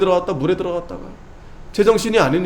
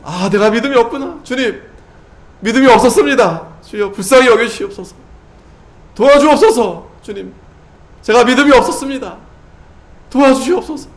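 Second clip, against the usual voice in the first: pleading prayer; 6 semitones higher, 3 dB louder than usual. Acted. Believer's voiced repentant prayer.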